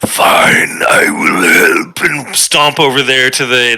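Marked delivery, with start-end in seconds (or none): 0.1-2.0 reluctant, low and slow; sulky giving in to helping; grudging. Acted. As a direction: give in with a huff. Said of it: "I bring myself to".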